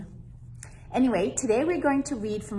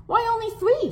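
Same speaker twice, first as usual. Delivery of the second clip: put-on puzzled child; 4 dB louder, faster than usual. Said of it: "Sofia asked".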